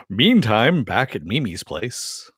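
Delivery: slow, booming trailer announcer; dramatic movie trailer narrator. Shown as a demonstration. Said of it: "That's my announcer voice".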